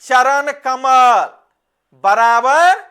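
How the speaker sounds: "चरण कमल" said very slowly, each word apart; slow dictation. Shown as a demonstration. Teacher to class, announcing the example slowly. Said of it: "कौन कमल?".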